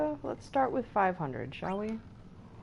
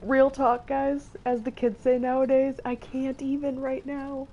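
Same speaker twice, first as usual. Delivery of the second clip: high, loud overjoyed gushing; bursting with delight; excited; 9 semitones higher, 5 dB louder than usual. Real, not acted. Overjoyed reacting to chocobos.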